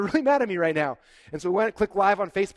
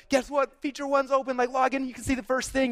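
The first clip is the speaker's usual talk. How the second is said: high, eager mock announcement; excited announcement; 8 semitones higher than usual. Founder announcing the new feature to the list, excitedly. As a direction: hype the news like a pitch.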